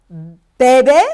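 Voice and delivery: bebe loud and rising; slow Spanish drill. Shown as a demonstration. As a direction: model it for the class. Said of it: "Let's do drink coffee".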